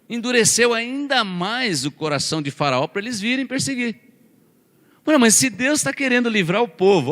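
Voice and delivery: preacher raising the stakes; rising preaching. Acted. Preaching rises in pitch.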